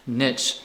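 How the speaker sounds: niche said as "nitch"; niche rhyming with pitch; own pronunciation. Shown as a demonstration. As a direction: say niche with a short i and a tch ending. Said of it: "which is how I pronounce it".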